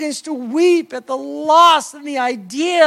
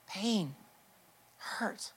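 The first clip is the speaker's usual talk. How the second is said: breathy whisper, voice gone; whispered hurt; 6 semitones lower, 18 dB quieter than usual. Acted. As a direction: let the word drop into a whisper. Listing pain after long pauses.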